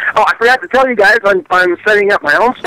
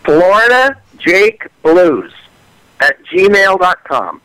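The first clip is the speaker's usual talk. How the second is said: slow; slower than usual. Real, not acted. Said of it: "Just like it sounds".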